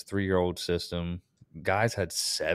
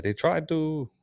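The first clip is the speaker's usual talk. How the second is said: imitating a French customer's accent, pitched up and badly; a hammed-up French accent, given up at once; a terrible mock French accent; 8 semitones higher, slower than usual. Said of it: "that's a terrible accent".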